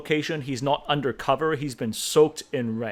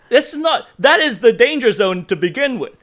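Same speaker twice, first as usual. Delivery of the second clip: worked up; 8 semitones higher, 7 dB louder than usual. Real, not acted. Worked up about the scene.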